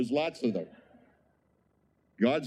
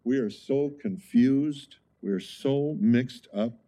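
slow emphasis; slower than usual. Real, not acted. Preacher laments confused world.

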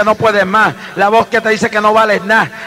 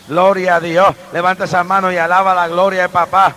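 ordinary talk

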